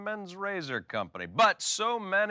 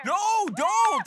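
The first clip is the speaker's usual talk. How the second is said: alarmed shout; 12 semitones higher, 5 dB louder than usual. Real, not acted. Host alarmed by fire remark.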